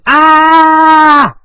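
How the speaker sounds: loud, high, sustained "ah" scream. Acted. Teacher acting out a man running from a building, screaming. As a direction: let out one long, high yell. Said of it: "and he's screaming".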